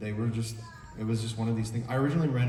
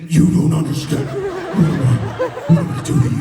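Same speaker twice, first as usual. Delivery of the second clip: louder hammed-up drama; laughing mock intense; 6 semitones higher, 10 dB louder than usual. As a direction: ham up the sudden intense scene. Acting intense scene at action.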